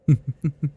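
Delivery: short predatory chuckle; amused chuckle. Acted. Vampire catches captive trying to run, amused chuckle. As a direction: chuckle at the prey's escape.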